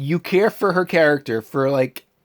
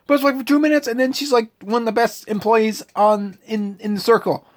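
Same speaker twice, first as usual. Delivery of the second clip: incredulous; 8 semitones higher, 3 dB louder than usual. Real, not acted. Incredulous complaint.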